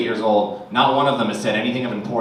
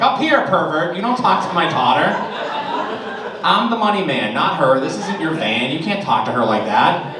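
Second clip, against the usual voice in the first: louder, higher mock-angry dad; protective scolding; 6 semitones higher, 3 dB louder than usual. Dad confronting creepy clerk, loud mock-angry scolding. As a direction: bark it like a protective father.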